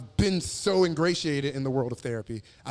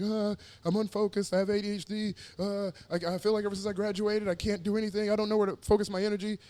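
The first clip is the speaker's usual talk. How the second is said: lightly voiced younger self, higher; higher pleading voice; 6 semitones higher, 3 dB quieter than usual. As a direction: sound lost and pleading. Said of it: "I was like".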